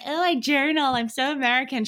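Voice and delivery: mocking. Teasing colleague who tells clients to journal, mocking. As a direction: send her up, pitched high. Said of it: "I'm always taking the piss out of her".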